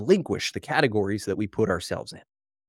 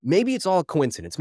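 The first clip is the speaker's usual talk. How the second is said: ordinary talk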